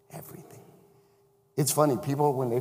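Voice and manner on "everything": hushed whisper of a secret. Acted. Preacher whispering to the congregation. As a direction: drop to a whisper on the word.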